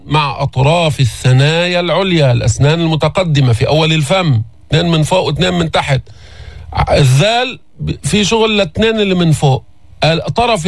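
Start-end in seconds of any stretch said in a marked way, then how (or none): none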